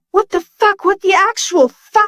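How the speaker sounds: high, stunned what the fuck; can't believe what he sees; shocked disbelief. Acted. Shocked at robot body.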